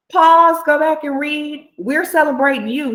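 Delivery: high, insistent cheerleading; emphatic friend. Retold or imitated. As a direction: open loud and correct them with delight. Friend's emphatic correction, retold.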